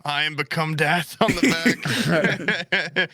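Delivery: very slow mock-solemn; mock dramatic. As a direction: drawl it like a grim prophecy.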